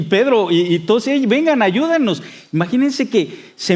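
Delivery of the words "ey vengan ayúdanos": high urgent call; raised excited cry; shouting for help. Retold or imitated. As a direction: yell to the other boat for help. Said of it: "la gente gritando".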